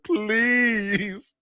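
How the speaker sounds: hammed-up pleading plea; pleading mayor. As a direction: imitate the mayor begging to keep the team. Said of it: "He's, like, trying his hardest".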